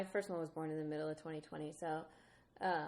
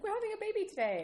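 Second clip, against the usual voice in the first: excited husband; 5 dB louder, faster than usual. Retold or imitated. Husband quoted, confident.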